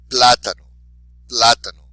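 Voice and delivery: Plátano with a very loud "Plá"; stress on accented syllable. Shown as a demonstration. Teacher modelling for repetition, Plátano punched on "Plá". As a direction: hit "Plá" hard in Plátano, then drop off. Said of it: "be sure to emphasize the accented syllable".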